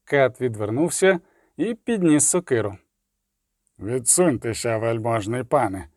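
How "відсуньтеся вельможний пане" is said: ominous, muffled voice. A narrator's reading. Executioner warning the official back, low and ominous. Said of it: "сказав зловорожим глухим голосом".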